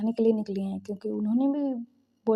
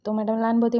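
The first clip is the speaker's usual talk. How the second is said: ordinary talk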